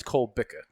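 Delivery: "Cole Bica" as kohl BIK-uh; "Cole Bica" in two parts, Cole then BIK-uh; confirmed pronunciation. Shown as a demonstration. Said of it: "we just confirmed it's".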